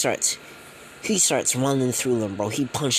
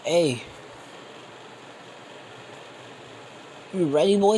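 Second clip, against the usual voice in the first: challenging call; 7 semitones higher than usual. Acted. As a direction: call him out to fight. Challenger before the fight.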